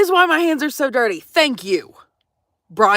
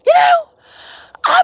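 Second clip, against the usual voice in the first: sudden much higher, louder squeak; squeaky aside; 6 dB louder than usual. Real, not acted. Ranting to viewers about dirty hands, sudden squeak. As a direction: squeal the words out.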